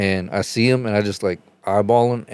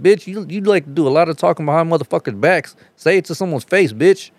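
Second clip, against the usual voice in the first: much higher, heated challenge; angry confrontation; 8 semitones higher than usual. Retold or imitated. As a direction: turn around and call him out. Turning back to confront him.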